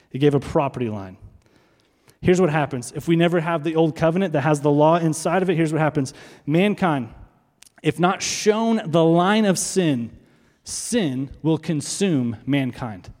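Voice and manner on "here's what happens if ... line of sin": normal talking voice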